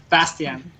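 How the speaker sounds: "Bastian" slowly in an American accent. Shown as a demonstration. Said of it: "American accent".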